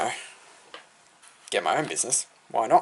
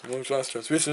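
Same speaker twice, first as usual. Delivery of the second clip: air traffic controller; 7 dB quieter than usual. Retold or imitated. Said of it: "start going".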